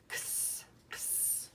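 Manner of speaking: x whispered as a soft ks, no voice; x sound. Shown as a demonstration. Teacher models the letter sound for pupils, whispered ks. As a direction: breathe out a quiet ks. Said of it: "It makes the sound x".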